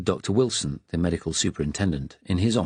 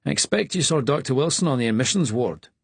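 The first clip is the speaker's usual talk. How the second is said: higher, easy chatty Scottish voice; casual small talk in a Scottish accent; conversational Scottish; 6 semitones higher than usual. Acted. Attendant chatting under the umbrella, casual Scottish accent. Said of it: "Ben said conversationally".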